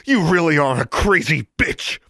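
high, loud angry snarl; angry outburst, pitch jumping up. A narrator's reading. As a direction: spit the insult out furiously. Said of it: "Alexandra snarled".

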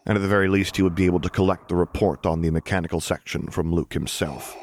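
ordinary delivery

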